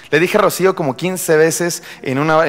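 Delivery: ordinary delivery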